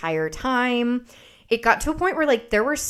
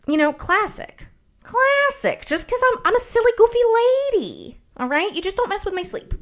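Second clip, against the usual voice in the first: high-pitched goofy lady voice; playful high sing-song self-mockery; mock silly lady; 13 semitones higher, 4 dB louder than usual. Mocking herself as a silly lady.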